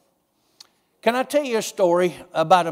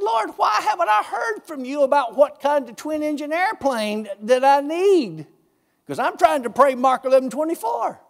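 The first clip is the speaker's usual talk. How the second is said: pleading to the Lord; 7 semitones higher than usual. Retold or imitated. Preacher retelling his plea to the Lord, high and shouting. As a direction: cry out to God in earnest frustration. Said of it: "And I said to the Lord".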